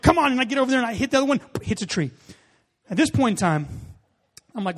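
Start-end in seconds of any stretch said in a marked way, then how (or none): 0.0-0.3 loud, high exasperated cry; mock exasperated yell; retold frustration. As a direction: shout at your own bad luck. Retold frustration after bad shot.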